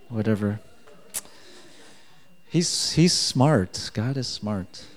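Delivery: ordinary talk, nothing marked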